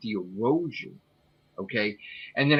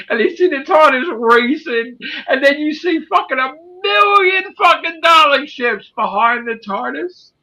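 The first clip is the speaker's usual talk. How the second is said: high, loud, breathless telling; excited narration; 13 semitones higher, 6 dB louder than usual. Real, not acted. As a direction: shout out the chase. Dramatic chase narration.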